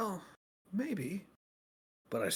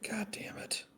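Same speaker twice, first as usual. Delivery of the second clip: hushed, frustrated curse; whispered curse; 9 semitones higher, 4 dB quieter than usual. Real, not acted. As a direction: whisper it under your breath.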